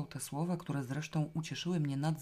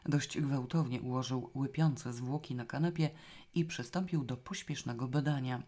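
plain reading voice

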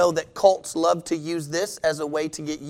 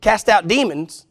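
louder, higher and pressing hard; louder retake; 4 semitones higher, 7 dB louder than usual. Shown as a demonstration. Preacher repeating his point louder to the church. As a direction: hammer the point home in full preaching voice. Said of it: "I'll do it again".

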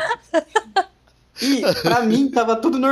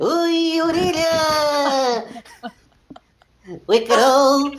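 high, squeaky SpongeBob-like voice; squeaky helium voice; 7 semitones higher, much slower than usual. Real, not acted. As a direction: sound like you breathed helium. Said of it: "igualzinho quem acabou de cheirar gazelha". (voz de quem cheirou gás hélio)

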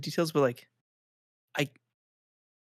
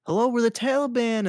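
high, bright mock welcome, pitched way up; mock cheery greeting; 10 semitones higher, 3 dB louder than usual. Friend retelling the Taliban walking in: high mock-cheery hello. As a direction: play the Taliban greeting empty base cheerfully.

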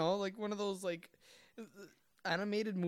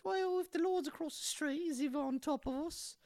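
put-on much higher, prim little boy. Little boy questioning the priest in a high prim voice. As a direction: play a polite, innocent little boy. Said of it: "He says".

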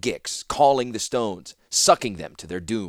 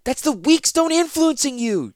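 high, loud shout; 6 dB louder than usual. Acted. Zancha shouting at Ratapi, high and loud. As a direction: shout him down in fear. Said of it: "she shouted".

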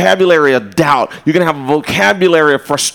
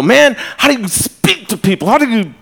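lightly voiced kid, higher and eager; excited little boy; 8 semitones higher than usual. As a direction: play the fired-up little dude asking. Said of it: "this little dude came up to me and go".

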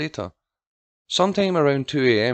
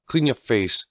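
ordinary delivery, nothing marked

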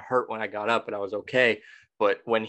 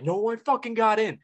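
retold angry yell; 12 semitones higher than usual. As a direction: replay the argument as a high-pitched half-shout. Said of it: "screaming at each other".

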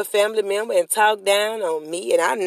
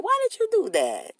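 exasperated high question; 11 semitones higher than usual. Real, not acted.

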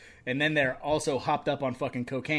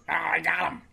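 gleeful character grab, rising louder at "beer"; goofy beer thief; 3 semitones higher, 6 dB louder than usual. Acted. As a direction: play a dopey crook snatching the beer. Voicing a character grabbing beer.

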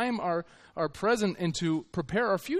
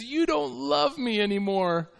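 wife's complaint; 7 semitones higher, slower than usual. Retold or imitated. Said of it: "and she says".

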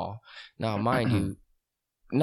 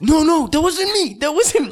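much higher and louder, frantic; panicked denial; 10 dB louder than usual. Retold or imitated. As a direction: plead innocence in terror. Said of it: "And Johnny goes".

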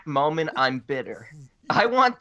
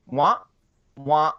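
usual speaking voice

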